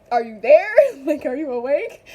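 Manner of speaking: loud mock "anyone home?" question, rising; imitating a puzzled check-in. Acting out pastor checking on her.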